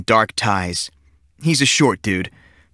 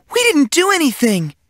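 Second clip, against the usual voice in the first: put-on girl insisting to the teacher they did nothing; girl's protest. A narrator's reading. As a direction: whine it out like an innocent girl.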